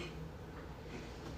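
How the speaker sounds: soft, voiceless tearful breath; crying. Real, not acted. Calling friends here her family, starting to cry. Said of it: "I said I wasn't gonna cry".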